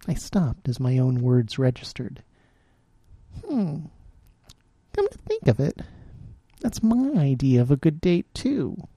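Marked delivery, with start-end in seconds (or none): 3.4-8.9 higher, musing then wry; a lightly voiced amused woman; amused realization. A narrator's reading. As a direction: catch yourself and smile at it.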